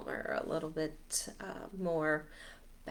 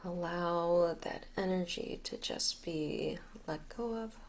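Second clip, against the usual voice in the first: guided meditation; 6 dB quieter than usual. Acted. Leader guiding listeners to release and get grounded, guided meditation. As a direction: talk them gently through letting go, unhurried.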